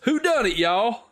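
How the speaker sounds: put-on high, laughing Southern accent; Poirot playing a Southern detective. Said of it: "Hercule Poirot comes on the Orient Express and goes".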